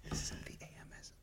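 soft breathy ASMR whisper into the mic; teasing ASMR whisper; mock ASMR whisper. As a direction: parody an ASMR video, whispering close. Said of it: "those A S M R videos".

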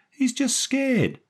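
very high, slow woman's voice; protective woman. A narrator's reading. Daphne defending the boy to Jerry, high protective woman. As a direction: plead for him in a high, drawn-out voice.

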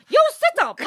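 high mimicked order; masseuse. Masseuse ordering client upright, high-pitched imitation. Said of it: "And then she goes".

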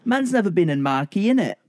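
high mock London roadman voice; london roadman accent. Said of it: "A flawless British accent".

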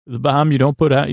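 ordinary delivery

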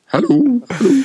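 little man calling. Retold or imitated. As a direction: call out like the man entering the room. Mimics man entering room again.

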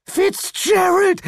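very high, slow horrified call; high drawn-out call; wife calling. A narrator's reading. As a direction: cry after her husband. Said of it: "rief seine Begleiterin".

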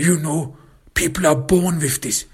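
high-pitched whining self-justification; pleading excuse. Acted. Abuser pleading his excuse in retelling.

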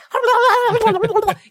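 high shrill burst of laughter; loud shrieking laugh. Pretend. Loud laugh imitating kid.

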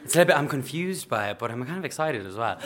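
plain reading voice

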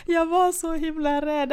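high-pitched, laughter-lifted confession; laughing recollection. Real, not acted. Laughing while recalling driving fear.

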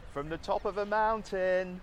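big booming trailer narrator. Pretend. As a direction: announce it like an epic documentary. Mock grand trailer presenter.

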